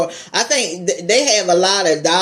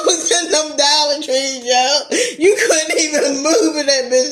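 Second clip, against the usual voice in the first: laughing; 9 semitones higher than usual. Real, not acted. Laughing about crowded dollar store.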